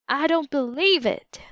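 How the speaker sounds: shouted in disbelief, high and loud; yelling. A narrator's reading. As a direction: yell it out in shock. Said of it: "Dink could hear Jude yell".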